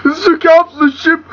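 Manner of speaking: very high, whimpering protest; panicked, tearful high-pitched prisoner; choked captive. Acted. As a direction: squeal it as if being strangled. Choked captive answers Vader.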